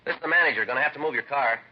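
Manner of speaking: loud announcement to someone inside; calling through a door. Acted. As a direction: call in as the building manager. Officer poses as manager outside door.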